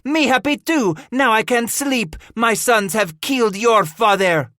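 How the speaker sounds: high-pitched, loud gloating; shrieking. A narrator's reading. The woman gloats over the brothers' dead father, shrieking. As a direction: shriek the taunt right back. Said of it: "the woman shrieked".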